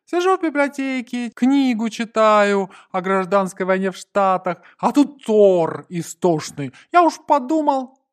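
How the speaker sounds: high, loud character voice; grumbling Gonza, aggrieved. A narrator's reading. Gonza to friend about the scream, high aggrieved voice. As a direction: recount the disturbance in loud complaint.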